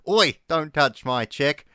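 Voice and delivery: lightly voiced angry bird mum; protective mother bird. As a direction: scold them like a cross mum guarding her chick. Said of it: "Mum coming back going".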